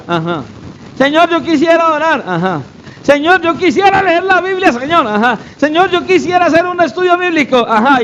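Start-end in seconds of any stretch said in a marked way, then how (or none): 0.9-2.2 high-pitched pious wishing; mock wishful prayer. Believer only wishing to God, mock wishful prayer. 3.0-5.1 high-pitched pious wishing; mock wishful prayer. Believer only wishing to God, mock wishful prayer. 5.6-7.6 quick, high-pitched pious wishing; mock wishful prayer. Believer only wishing to God, quick mock prayer.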